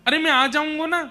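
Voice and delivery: high-pitched, loud, urgent reassurance; child reassuring mother. Acted. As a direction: plead with your crying mother to calm down. Krishna comforts his weeping, distraught mother.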